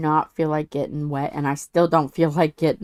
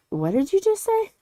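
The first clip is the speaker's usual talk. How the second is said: much higher, puzzled question; mock bewildered relative, pitched up; baffled family member; 13 semitones higher than usual. Family reacting to the fast high talk, baffled. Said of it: "And then they're like".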